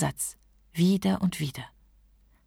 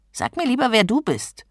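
higher-pitched, testy retort; unfriendly; 6 semitones higher, 4 dB louder than usual. A narrator's reading. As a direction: snap back like an annoyed genie. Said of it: "schien ihm die Stimme nicht sehr freundlich". (unfreundlich, gereizt)

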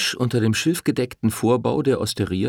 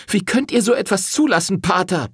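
high, loud outraged yell; woman confronting the priest, shouting in rage; furious shout; 12 semitones higher, 4 dB louder than usual. Acted. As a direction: roar it at the priest. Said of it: "brüllte sie".

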